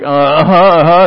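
loud, mock-polite uh-huhs; feigned understanding. Friends hearing the uncle they can't understand, mock agreeing. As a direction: imitate baffled listeners playing along.

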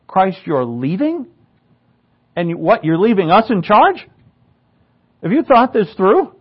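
acted disbelief, questions rising high at the end; incredulous disciples. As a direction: question it in mock alarm, pitch climbing. Acted disciples doubting Christ's plan.

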